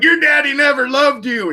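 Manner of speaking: high, hammed-up heckling yell; mock cruel shout. Imagining himself heckling strippers while throwing coins, mock cruel shout.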